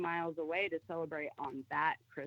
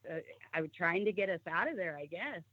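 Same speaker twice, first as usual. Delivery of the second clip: ordinary talk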